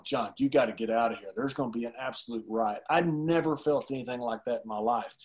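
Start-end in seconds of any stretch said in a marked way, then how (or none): 0.0-2.8 lower-pitched, softer imitation of police; understated, confidential caution; low retold warning. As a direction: drop your voice for a discreet warning. Police quietly warning him at ringside.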